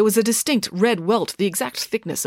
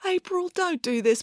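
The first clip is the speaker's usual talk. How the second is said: higher, softer plea; pleading; 12 semitones higher, 4 dB quieter than usual. Acted. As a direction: beg her to stop. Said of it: "pleaded Joe".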